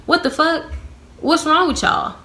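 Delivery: high-pitched incredulous questioning; exasperated disbelief. Retold or imitated. Reacting to accusations in the back office, exasperated. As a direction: act out being appalled at them.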